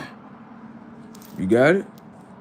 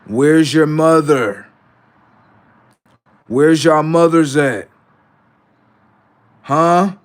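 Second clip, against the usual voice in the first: louder slow taunt; 3 semitones higher, 5 dB louder, slower than usual. Shown as a demonstration. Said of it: "repeated taunting question".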